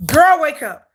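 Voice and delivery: loud, high call to wake someone; urgent wake-up call. Retold or imitated. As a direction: shout her awake, mock urgency. Sister-in-law calling to wake her.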